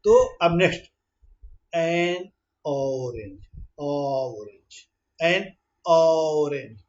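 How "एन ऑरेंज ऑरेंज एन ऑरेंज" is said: slow, vowel sound drawn out; slow motion. Shown as a demonstration. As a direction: stretch the opening vowel. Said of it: "ये देखिए vowel का sound कर रहा है".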